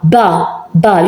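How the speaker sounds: ordinary talk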